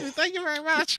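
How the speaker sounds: high, put-on thank-you very much; mock showman voice. Host thanking his co-host in a hammed-up showman voice. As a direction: ham it up like a crooner taking a bow.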